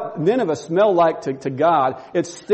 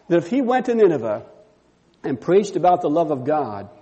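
ordinary talk

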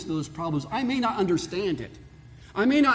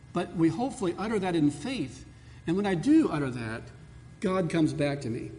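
usual speaking voice

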